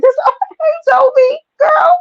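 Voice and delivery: high, loud delighted shout; excited squeal. Real, not acted. Hyping up Dovey on stream, a squealing shout. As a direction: squeal her name with joy. Hyped greeting to a friend.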